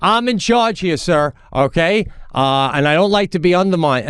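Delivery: high, indignant mock refusal; imitated officious coach. Coach refusing a dad's request, mock officious indignation. Coach asserting authority, acted quarrel.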